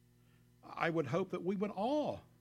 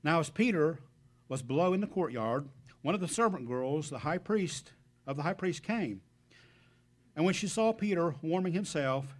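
louder, measured Bible reading; scripture reading; 5 dB louder than usual. Acted. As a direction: proclaim the verses to the congregation. Preacher reads scripture aloud.

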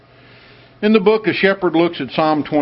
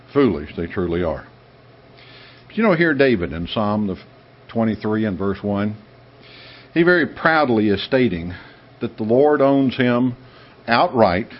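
ordinary talk, nothing marked

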